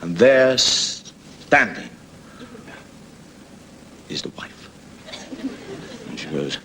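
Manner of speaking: ordinary talk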